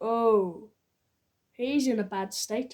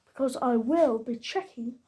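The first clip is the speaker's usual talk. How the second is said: ordinary talk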